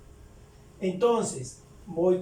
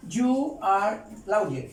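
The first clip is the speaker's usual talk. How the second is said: You are lawyer drawn out slowly; teacher slowing down You are lawyer; slow model; much slower than usual. Shown as a demonstration. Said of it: "Ahí estoy diciendo".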